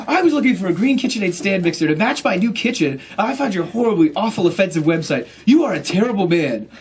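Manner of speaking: loud, high, indignant rant; hammed-up angry customer; furious customer. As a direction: act out an outraged complainer. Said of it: "he was furious".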